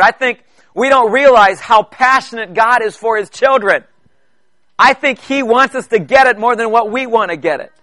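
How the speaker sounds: preacher pleading with his congregation, building and slowing; building preaching. Real, not acted. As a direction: drive the point home, word by word.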